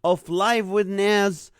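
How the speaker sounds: loud, upbeat live show host opening; big announcer energy welcoming each viewer by name; show host welcome. Acted. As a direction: greet the audience like you're opening a TV show.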